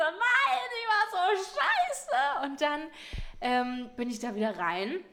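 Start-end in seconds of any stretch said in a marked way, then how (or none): 0.0-2.4 high, slow tearful wail. Retold or imitated. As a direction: wail it out, hammed-up. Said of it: "Und ich so". (hoch, gedehnt und weinerlich)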